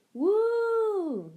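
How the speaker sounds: high, loud mock ghost wail; spooky ghost woo. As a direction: hoot like a pretend ghost. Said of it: "in a spooky voice".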